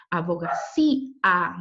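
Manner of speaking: "Abogacía" slowed, with cí and a pulled apart; split into syllables. Shown as a demonstration. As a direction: stretch the end of "Abogacía" into two beats, cí, a. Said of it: "dos sílabas diferentes y separadas las vocales".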